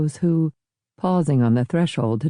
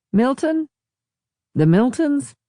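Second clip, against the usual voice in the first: raised, questioning guess; guessing aloud; 11 semitones higher than usual. Acted. Guest to host, guessing the family's name. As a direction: try the name out, unsure.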